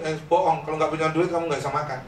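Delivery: ordinary delivery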